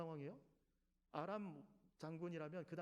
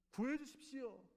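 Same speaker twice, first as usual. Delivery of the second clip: higher cry for rescue; pleading prayer; 6 semitones higher, 4 dB louder, slower than usual. Acted. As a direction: beg urgently. Prophet's plea acted.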